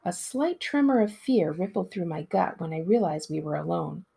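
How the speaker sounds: ordinary delivery, nothing marked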